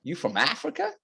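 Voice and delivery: high, rising, gushing American voice; mock American accent. Imitating an American woman excited about his accent. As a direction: gush like an excited American fan. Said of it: "what I can do is".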